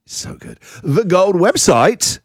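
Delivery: slow, grand announcer plug. Acted. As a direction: sell it like an ad. Hyped website plug.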